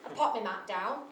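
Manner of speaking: normal talking voice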